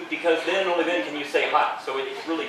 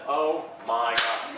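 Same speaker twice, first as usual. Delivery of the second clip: slow, low, melting "Oh my God"; swooning; 5 semitones lower, much slower than usual. Retold or imitated. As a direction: let it melt out slowly, overwhelmed. Shoulder rub from the special person.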